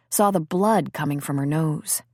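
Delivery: usual speaking voice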